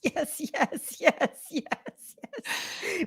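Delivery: high, soft yeses through laughter; gleeful laughing approval. Real, not acted. Listener delighted by the middle-finger ending, laughing approval. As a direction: chant yes while laughing.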